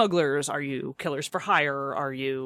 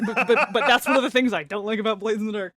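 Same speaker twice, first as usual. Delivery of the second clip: louder, higher, through laughter; laughing admission; 6 semitones higher, 6 dB louder than usual. Real, not acted. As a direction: blurt it out while cracking up. Admits complaint while cracking up.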